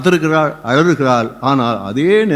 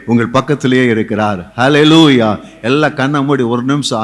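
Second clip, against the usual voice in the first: normal talking voice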